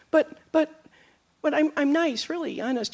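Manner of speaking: small, high, soft protest; mock wounded innocence; feeble self-defense. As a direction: stammer a meek plea, trailing away. Acts out someone feebly defending themselves.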